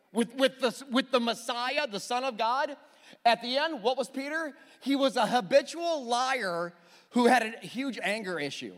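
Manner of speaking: ordinary talk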